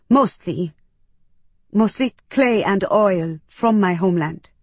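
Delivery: plain reading voice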